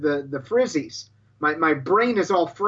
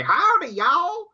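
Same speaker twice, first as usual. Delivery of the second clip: high, drawn-out Southern Biloxi twang; hammed-up Biloxi holler, stretched out slowly; exaggerated Mississippi accent greeting; mock Biloxi accent; 12 semitones higher, slower than usual. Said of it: "they have weird accents anyway".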